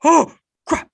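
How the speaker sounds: sudden high alarmed outburst; panicked. Retold or imitated. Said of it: "I'm like".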